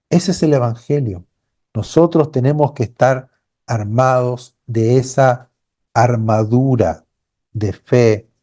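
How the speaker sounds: ordinary talk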